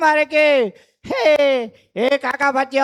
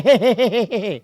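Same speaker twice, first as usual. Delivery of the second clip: happy giggle; 2 semitones lower than usual. Acted. Goat laughing after shout.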